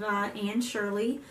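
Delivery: ordinary talk, nothing marked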